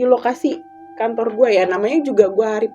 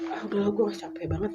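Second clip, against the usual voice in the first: exhausted sigh; 11 dB quieter, faster than usual. Retold or imitated. Exhausted, giving up.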